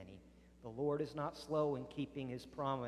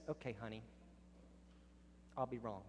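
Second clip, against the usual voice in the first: quieter, lower, giving in; soft surrender; 3 semitones lower, 9 dB quieter, faster than usual. Acted. Worn-out spouse finally backing down, soft and resigned. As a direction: sigh and concede gently.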